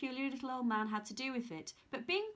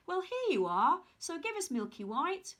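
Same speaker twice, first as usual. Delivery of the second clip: put-on sly old man; 6 semitones higher, 4 dB louder than usual. Old man offers beans.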